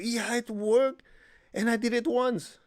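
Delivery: high, slow, beaming gush; enthusiastic. Retold or imitated. Imitating the student's eager enthusiasm on the phone. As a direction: sell it like the best news ever. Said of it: "She said with such enthusiasm".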